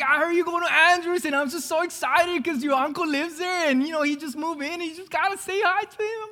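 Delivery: put-on high, excited grandma with a Spanish accent; grandma's Spanish accent. Grandma excited about grandson seeing uncle, high Spanish accent. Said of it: "she speaks with a little accent".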